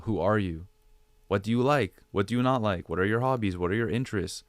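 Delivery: normal talking voice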